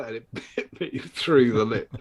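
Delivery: ordinary talk, nothing marked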